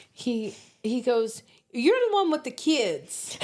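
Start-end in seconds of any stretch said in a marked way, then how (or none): 1.7-3.4 high-pitched Southern kid imitation; mock Southern boy, pitched up; Southern accent. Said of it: "in his southern accent".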